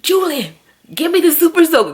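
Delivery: high and loud, fast call; urgent yell. Retold or imitated. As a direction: shout to a kid in a hurry. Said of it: "I was like".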